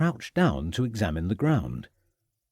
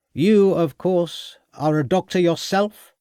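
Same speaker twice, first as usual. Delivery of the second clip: sharp, louder sneering question, slow on "You, of course"; snapped; 8 semitones higher, 4 dB louder, slower than usual. A narrator's reading. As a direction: cut him down with a sneer. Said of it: "snapped Summersby".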